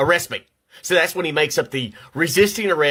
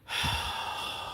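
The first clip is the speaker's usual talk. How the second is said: sigh; 13 dB quieter than usual. Real, not acted. Frustrated with wife's computer changes.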